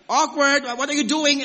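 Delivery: high, loud startled recoil; mock shocked what are you doing; awkward surprise. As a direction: act out being weirded out by a stranger. Mock reaction to stranger's tissue.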